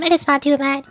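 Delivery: usual speaking voice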